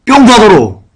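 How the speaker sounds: high, punched exclamation; sudden pulpit outburst; exclaimed urging. Real, not acted.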